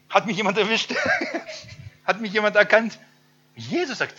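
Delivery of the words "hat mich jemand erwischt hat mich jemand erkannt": high, loud, laughing disbelief as Zachäus; laughing surprise. Acted. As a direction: caught out and laughing about it. Zachäus voiced, caught up a tree. (Zachäus, lachend und überrascht)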